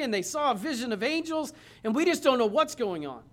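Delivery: voicing bewildered followers pouring out the news; distraught disciples. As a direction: disciples telling the stranger their hopes died. Disciples recount crucifixion to Jesus.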